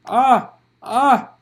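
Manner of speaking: loud high pained cries; loud pained groans; labour groans. Acted. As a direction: cry out pushing. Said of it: "groaned Farah".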